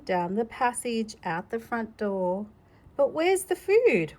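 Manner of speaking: plain reading voice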